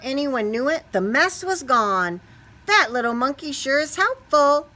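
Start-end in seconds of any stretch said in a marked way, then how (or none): 2.7-4.7 cheerful janitor. Acted. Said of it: "the janitor said".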